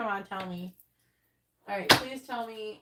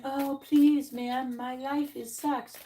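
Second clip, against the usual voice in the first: higher, louder hammed-up beggar; mock begging plea; 4 semitones higher, 4 dB louder, much slower than usual. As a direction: lay on the pity, mockingly. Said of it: "you see how people come in".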